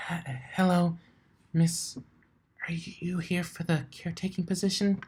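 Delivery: high, breathless frightened whisper; shy whispered greeting; timid whisper. Acted. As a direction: barely dare to speak. Timid Fluttershy greets huge pony.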